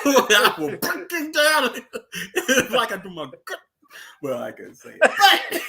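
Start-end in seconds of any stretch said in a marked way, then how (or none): 0.0-3.3 imitating Chief. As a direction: mimic Chief's line while cracking up. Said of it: "you can tell how he talk".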